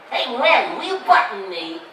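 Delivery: high, loud cartoon cat voice; Stimpy voice. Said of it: "Stimpy was, uh, like".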